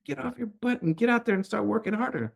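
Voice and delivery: high-pitched mock yell at a lazy kid. Mother retelling how she'd yell at her lazy daughter, mock yell. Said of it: "Sometimes I just wanna yell at her".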